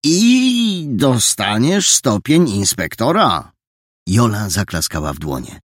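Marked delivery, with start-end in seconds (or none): 0.0-3.5 slow, ceremonious pronouncement; slow solemn proclamation; with solemnity. Acted. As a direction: bestow the rank like a grand honour. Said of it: "Dokończył z namaszczeniem".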